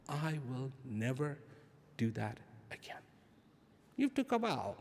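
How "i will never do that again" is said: a much softer vow to yourself; quiet vow. Retold or imitated. Person after hurting a loved one, quietly vowing to themselves. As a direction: murmur the promise inward, remorseful. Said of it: "you say to yourself".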